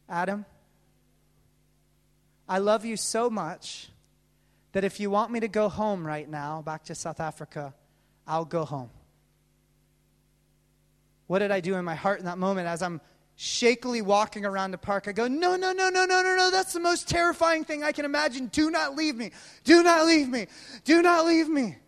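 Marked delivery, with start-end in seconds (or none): 0.1-8.9 low, softened loving promise; quiet, intimate and earnest; tender reassurance. Retold or imitated. Voices his partner's tender promise. 15.3-21.8 desperate mock-inner cry; panicked begging.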